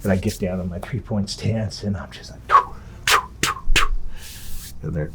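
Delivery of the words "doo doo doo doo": short, airy mouth-made "doo" bursts; mimicked running noises; imitated sprint sounds. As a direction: puff out quick sound effects for running strides. Imitating his sprint off the line.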